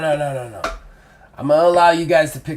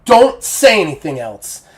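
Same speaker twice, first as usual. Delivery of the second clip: warning; 2 semitones higher, 5 dB louder than usual. Acted. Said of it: "he gives you a hard look".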